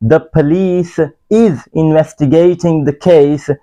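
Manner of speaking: slow model sentence; wrong example. Shown as a demonstration. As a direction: read the wrong example slowly. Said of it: "गलत है". (गलत वाक्य धीरे-धीरे)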